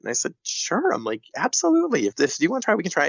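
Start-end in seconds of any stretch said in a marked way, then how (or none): 0.4-0.9 high bright agreeing sure; retold cheerful yes in a much higher voice; mock eager sure. Retelling her own reply to the mom. 1.4-3.1 high, upbeat encouraging voice; doctor retelling her eager go-ahead, pitched up high; mock enthusiastic. Retelling herself reassuring the mom.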